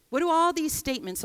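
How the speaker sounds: usual speaking voice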